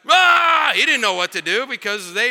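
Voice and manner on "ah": sudden furious yell, high and loud; angry shout. Retold or imitated. Angry villager yelling as the tribe follows the missionaries. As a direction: let out a frustrated roar. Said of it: "the angry guy was like".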